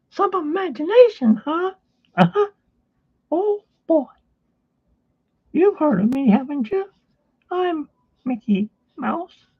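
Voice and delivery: very high, squeaky cartoon mouse falsetto; cheerful, strained squeak greeting the kids; friendly cartoon mouse hosting for children; Mickey Mouse voice. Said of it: "You know, that kind of voice".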